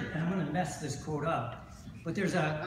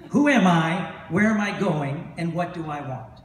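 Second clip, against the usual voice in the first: loud question; 8 dB louder than usual. Retold or imitated. Rabbi quotes the question loudly.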